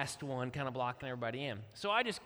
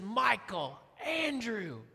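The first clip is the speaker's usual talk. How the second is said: loud, stern full-name call; muddy wife summoning her husband, stern; middle-name warning; 6 semitones higher, 4 dB louder, much slower than usual. Retold or imitated. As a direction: call him out like a scolding parent. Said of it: "she uses my middle name".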